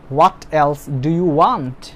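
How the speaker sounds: very slow, every word separated for the class; slow model sentence. Shown as a demonstration. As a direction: read it out slowly like a dictation. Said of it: "teacher models the English sentence". (ধীরে ধীরে ক্লাসে পড়ে শোনানো)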